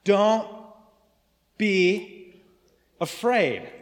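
each word spaced and loud; angel's reassurance. Acted. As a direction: plant every word: Don't, be, afraid. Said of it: "What did he say?".

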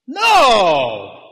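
loud high roar; mock battle roar. Football players charging out, big loud roar. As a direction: roar out of the tunnel.

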